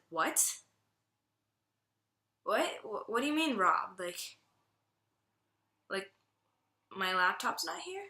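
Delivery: halting, rising questions of disbelief; stunned questions with long pauses between; retold disbelief. Friend to others on finding her things stolen, in disbelief. Retells disbelief at being robbed.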